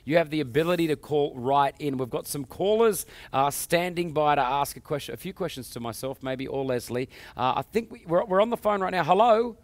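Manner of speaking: loud, high, upbeat show host announcing callers; TV host hype. Acted. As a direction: pump up the audience for the call-in. Host hypes the call-in segment.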